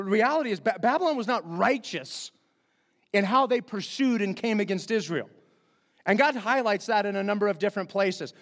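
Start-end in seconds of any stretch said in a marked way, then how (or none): none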